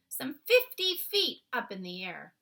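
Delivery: ordinary delivery, nothing marked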